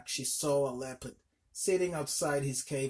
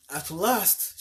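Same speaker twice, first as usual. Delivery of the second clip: louder relieved cry; exclaimed; 3 semitones higher, 6 dB louder than usual. A narrator's reading. Weary mother spotting the elephant's trees, relieved cry. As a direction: relief bursting out at last. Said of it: "she exclaimed".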